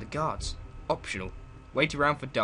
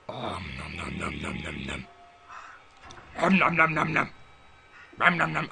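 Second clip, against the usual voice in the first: chainsaw-like nom nom chewing noises; greedy smacking while eating; 8 semitones lower than usual. Pretend. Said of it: "I sound like a chainsaw".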